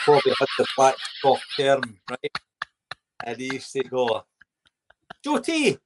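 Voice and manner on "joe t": high mock call across the room. Teammate calls the young player across the dressing room, high mock call. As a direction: sing out his name like a teammate. Teammate calling his name.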